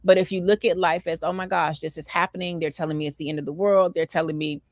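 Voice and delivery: usual speaking voice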